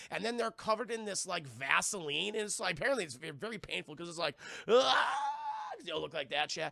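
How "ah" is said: loud high groan of pain; mock pained cry. Prisoners sealed in the pods, loud mock pained cry. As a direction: yelp like you're stuffed in the pod.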